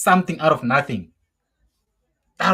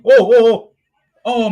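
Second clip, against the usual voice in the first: excited; 6 semitones higher, 11 dB louder than usual. Real, not acted. Fan reacting to a chance.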